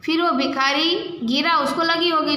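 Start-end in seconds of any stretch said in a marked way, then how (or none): none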